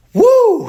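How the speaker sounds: very high, loud celebratory "woo"; sudden falsetto cheer; relieved whoop. Retold or imitated. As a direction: whoop it out like a hugely relieved dad. Dad celebrates the relieving news.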